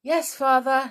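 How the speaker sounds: high, loud reassuring reply; shouted back. A narrator's reading. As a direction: shout an answer back to father. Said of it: "shouted back George".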